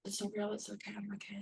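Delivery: murmured self-soothing, well below normal level; hushed denial. Retold or imitated. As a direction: repeat it softly to calm yourself. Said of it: "all these years in earth reality".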